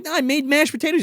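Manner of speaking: sing-song like a scheming mother; high syrupy mom calling down. Retold or imitated. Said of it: "She's like".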